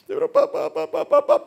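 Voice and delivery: very high, loud mock wailing; imitated crying daughter. Daughter crying to her father before hanging up, hammed-up wail. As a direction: bawl papá in a squeaky teenage sob. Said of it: "Yo estaba llorando".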